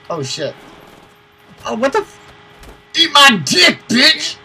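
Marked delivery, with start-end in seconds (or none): 1.6-4.4 loud, high trash-talk shout; triumphant yelling. Real, not acted. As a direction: yell in triumph over the kill. Kill in firefight, trash talk.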